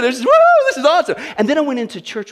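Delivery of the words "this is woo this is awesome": louder, higher, fast joyful woo; excited. Retold or imitated. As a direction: burst out with delight and a laugh. New believer's joy.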